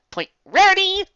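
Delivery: very high, loud call; high loud announcer cry; announced point. A narrator's reading.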